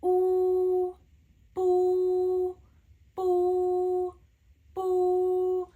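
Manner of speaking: "bu" said loud and a bit high, lips pushed round; loud Mandarin u. Shown as a demonstration. Teacher drills "bu" loudly for learners. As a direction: push the lips forward on each "bu". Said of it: "in Chinese it's pronounced as U".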